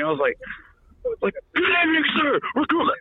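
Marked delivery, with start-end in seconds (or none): 1.5-2.9 high barked sound-off; recruit sound-off. Retold or imitated. Recruit sounding off to the drill instructor. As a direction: shout it like a raw recruit. Recruit reporting at night guard.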